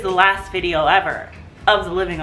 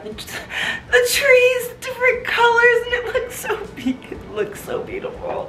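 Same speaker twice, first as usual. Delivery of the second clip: high, squeaky voice breaking into happy tears; overwhelmed, high-pitched weeping with laughs; real tearful crying. Real, not acted. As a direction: cry and laugh through it, fading out at the end. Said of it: "I'm literally crying right now".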